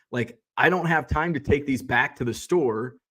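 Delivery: ordinary delivery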